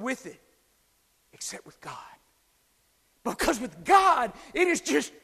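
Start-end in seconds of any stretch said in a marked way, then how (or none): 1.3-1.9 sudden whispered aside; whispered "except with God". Real, not acted. Sudden hush. 3.2-5.1 higher, louder thundering proclamation; full-force preacher cry; preaching shout. Real, not acted. Preacher climaxes.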